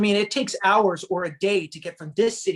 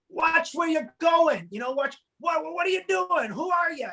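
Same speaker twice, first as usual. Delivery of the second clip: angry New Yorker; 10 semitones higher than usual. Retold or imitated. Rude New Yorker yelling at a mom with a stroller, mock angry. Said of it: "And this guy's like".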